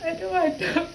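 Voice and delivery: fighting back real tears; holding back tears. Real, not acted. Vlogger fighting tears alone on camera, tearful. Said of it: "I don't even know why I'm crying".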